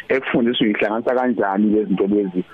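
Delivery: ordinary talk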